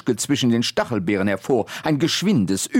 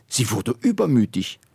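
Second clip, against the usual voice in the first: usual speaking voice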